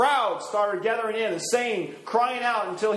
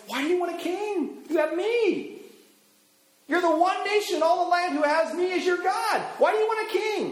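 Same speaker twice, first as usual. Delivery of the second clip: God's voice, much higher, pleading with his people; 12 semitones higher than usual. Retold or imitated. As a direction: ask like a hurt God who gave them everything. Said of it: "And God says to him".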